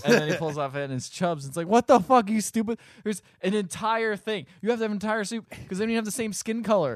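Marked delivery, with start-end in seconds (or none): 1.7-7.0 loud, high-pitched incredulous rant; mock outrage, voice pushed up; exasperated outburst. Recapper mocking the movie's diver-suit disguise, exasperated.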